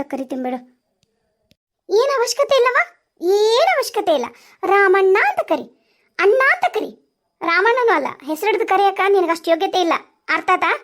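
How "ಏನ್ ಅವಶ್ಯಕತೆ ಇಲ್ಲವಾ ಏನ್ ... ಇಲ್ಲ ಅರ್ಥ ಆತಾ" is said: woman angrily telling him how to address her; 1 angry scolding. Acted. Scolding, refusing the name.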